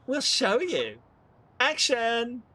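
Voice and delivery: high, loud showbiz call; theatrical announcement. Acted. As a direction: call it like a film director. Staging a scene announcement.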